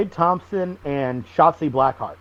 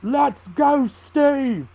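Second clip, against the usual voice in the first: high-pitched mock drunk heckle; high mock chant from a drunk fan; drunk fan yelling; 10 semitones higher, much slower than usual. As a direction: bellow it like a drunk fan at the rail. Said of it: "and he goes".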